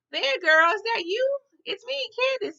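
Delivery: high-pitched, disbelieving call to a friend; surprised recognition. Retold or imitated. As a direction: imitate a friend's high, startled hello. Candace recognises her friend, calls out.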